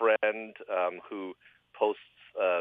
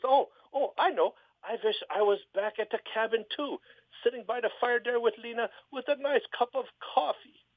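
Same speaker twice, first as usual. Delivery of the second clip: eager falsetto, much higher; high excited falsetto; 13 semitones higher than usual. Retold or imitated. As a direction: blurt the idea in falsetto. Ole voiced in joke.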